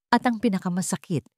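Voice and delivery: plain reading voice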